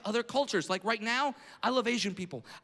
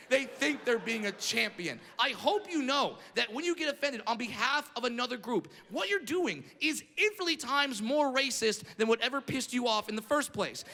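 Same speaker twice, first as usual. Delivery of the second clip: usual speaking voice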